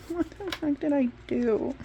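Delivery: high, baffled what the heck; half-laughing disbelief; mock-baffled reaction to the rejection email; bewildered whine.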